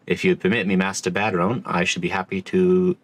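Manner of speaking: usual speaking voice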